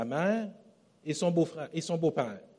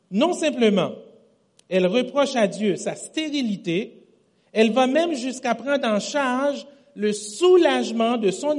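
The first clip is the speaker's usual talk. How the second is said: preaching emphasis; 5 semitones higher, 6 dB louder than usual. Real, not acted. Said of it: "ça c'est important".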